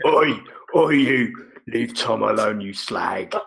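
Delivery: slow, mock-tough pigeon heavy warning someone off; hard-man pigeon neighbour. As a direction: play a thuggish neighbour pigeon, slowly. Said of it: "The pi-, the pigeon neighbours".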